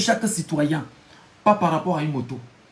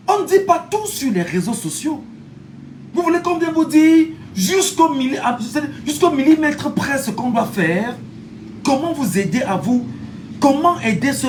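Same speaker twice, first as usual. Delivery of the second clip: rallying shout; 6 semitones higher than usual. Real, not acted. Fired-up address to followers.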